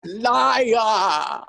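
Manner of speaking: long drawn-out falling cry; mock accusation. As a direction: wail it in fake outrage. Mock screaming match.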